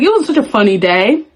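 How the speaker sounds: normal talking voice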